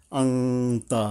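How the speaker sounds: আংতা with a nasal ং, no clear ন; hidden nasal. Shown as a demonstration. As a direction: hum the ং in আংতা. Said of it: "আনতা বলবো না".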